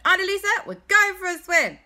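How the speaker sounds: put-on high, loud kids' holler; calling out. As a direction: excitedly announce it to auntie across the way. Boys call out to auntie.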